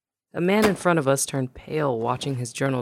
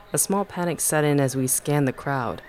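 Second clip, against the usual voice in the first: usual speaking voice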